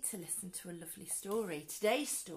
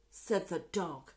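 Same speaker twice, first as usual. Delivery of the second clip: plain reading voice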